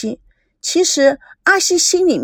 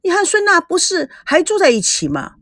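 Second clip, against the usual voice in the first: displeased; 8 semitones higher than usual. A narrator's reading. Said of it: "不满意地说".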